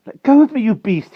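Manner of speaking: high, loud struggling protest; frightened cry. A narrator's reading. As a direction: yell to be released. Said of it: "he cried".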